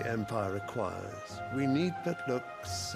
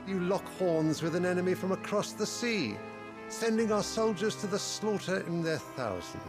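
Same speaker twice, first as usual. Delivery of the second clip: higher, raised voice of reproach; pitched up, scolding ruler; stern rebuke; 7 semitones higher than usual. Acted. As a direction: rebuke the council with rising indignation. Ruler rebukes his squabbling council.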